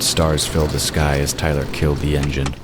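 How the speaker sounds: ordinary talk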